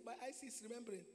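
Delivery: normal talking voice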